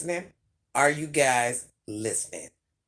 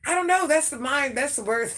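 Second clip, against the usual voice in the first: flustered protest; 10 semitones higher, 4 dB louder than usual. Real, not acted. Flustered defence of odd word.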